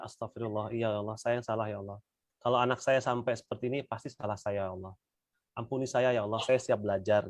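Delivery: a gentle sincere prayer of repentance; humble repentance. Shown as a demonstration. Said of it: "Istighfarnya itu udah".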